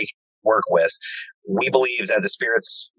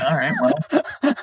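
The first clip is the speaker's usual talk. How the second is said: drawn-out awkward reply; 5 semitones higher, much slower than usual. Real, not acted. Reacting to spirit's curse.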